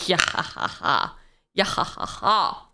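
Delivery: gruff pirate laugh-talk. Acted. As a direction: play a hearty pirate. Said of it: "It's my pirate voice".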